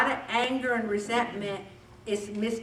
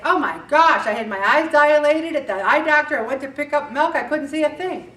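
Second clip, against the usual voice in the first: louder, higher apologetic explaining; lightly voiced flustered friend; Judy's explanation; 5 semitones higher, 7 dB louder than usual. Judy explaining she couldn't see, flustered and louder. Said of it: "And they say".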